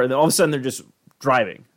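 normal talking voice

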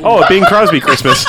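loud, high, slow through laughter; laughing. Real, not acted. Podcaster tops the joke about Christmas films, laughing. Joking while everyone laughs.

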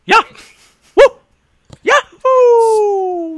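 very high, loud yahoo; excited whoop. Real, not acted. Celebrating the running segue joke, a very high loud whoop. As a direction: whoop it like a cowboy.

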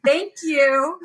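high, hammed-up inner voice backing out; mock panicked self-talk. To herself as her hand goes up, mock panic. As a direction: pull your own hand back down in a flustered squeak. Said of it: "I'm like".

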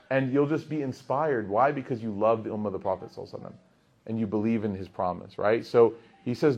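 normal talking voice

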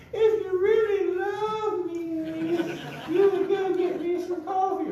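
high, drawn-out mock nagging wife; guilt-trip him in a hammed-up high voice; wheedling wife impression. Hypothetical wife guilting her husband into fetching coffee, high wheedling mock voice. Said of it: "she doesn't sound like that".

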